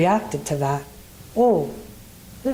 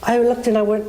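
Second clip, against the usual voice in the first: ordinary talk, nothing marked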